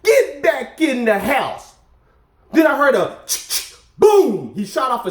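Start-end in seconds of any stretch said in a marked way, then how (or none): 0.0-1.8 slow, drawn-out mock Texas hillbilly accent, half laughing; a laughing imitation of a country hillbilly Texan ordering him inside. Neighbour in Texas barks him back indoors, lightly imitated hillbilly accent. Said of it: "big old thick country hillbilly accent".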